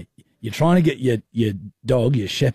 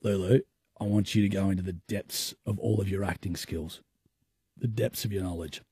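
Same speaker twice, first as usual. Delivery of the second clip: hushed; 4 semitones lower, 10 dB quieter than usual. Acted. Host sets up the roleplay hushed.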